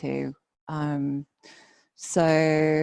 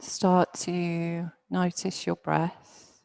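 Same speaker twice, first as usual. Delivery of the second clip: guided meditation. Real, not acted. Yoga teacher settling students into their bodies, guided meditation. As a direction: ease them down gently, phrase by phrase.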